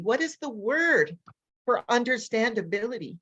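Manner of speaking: ordinary delivery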